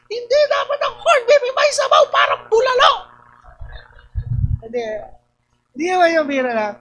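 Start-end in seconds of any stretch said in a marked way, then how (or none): 0.1-2.9 much higher mocking voice; mimicking spouse. Replaying the spouse's retort in the corned beef fight, high mock voice. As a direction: squeak out the other side of the argument.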